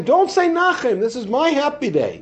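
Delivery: high, insistent, joyful proclamation; a rabbi telling his students not to mourn, high and insistent; emphatic quoted declaration. Retold or imitated. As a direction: announce it like the rabbi forbidding mourning. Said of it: "So he's saying".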